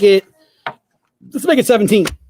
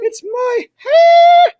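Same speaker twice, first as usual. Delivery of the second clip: high drawn-out wail, half shouting; hammed-up anguished cry over his hair; 6 dB louder, much slower than usual. Mock-tragic outburst over hair.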